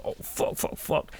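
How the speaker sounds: hushed whispered swearing; panicked whisper. Retold or imitated. Hiding from friends at the door, whispered panic. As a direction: whisper so nobody outside hears.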